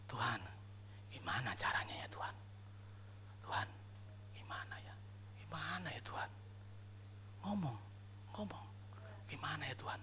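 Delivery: hushed, puzzled plea to God; soft, halting murmured prayer; whispered prayer. Shown as a demonstration. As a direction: whisper it privately, searching for an answer. Demonstrates confused private prayer to God.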